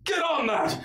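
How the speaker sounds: high, eager-to-obey subordinate; flustered sergeant. Acted. Sergeant answering orders to stop undead, high and flustered.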